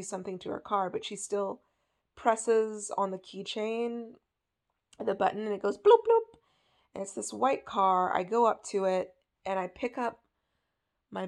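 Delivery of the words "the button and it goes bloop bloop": car beep imitation. Telling the dream, imitating the car lock beep. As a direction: mimic the car lock chirping, louder.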